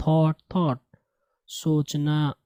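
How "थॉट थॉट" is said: "थॉट" with one long aw, "thawt"; correct thought. Shown as a demonstration. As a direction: model "थॉट" twice, no ow glide. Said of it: "इसको थाउट नहीं पढ़ेंगे". ("थॉट" درست تلفظ، گول آواز میں)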